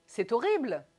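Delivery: emphatic quick hit on "horrible"; exclaimed intonation. Shown as a demonstration. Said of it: "dites différemment".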